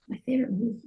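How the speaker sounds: unvoiced thinking aloud; whisper. Real, not acted. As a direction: whisper it to yourself. Whispers to herself.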